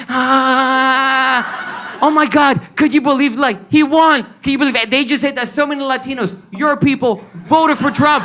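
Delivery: high-pitched, outraged mock wailing; upset wife. Wife waking husband over election news, mock outrage. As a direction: imitate an indignant wife waking you up. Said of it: "Y me despierta brava".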